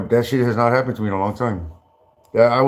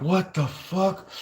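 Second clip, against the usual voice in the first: very slow, much higher and softer what the fuck; stunned disbelief; 8 semitones higher, 6 dB quieter, much slower than usual. Retold or imitated. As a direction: drag it out high and hushed in shock. Stunned reply to the cop.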